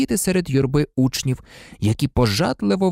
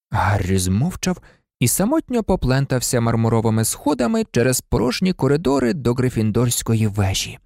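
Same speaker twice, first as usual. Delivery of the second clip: usual speaking voice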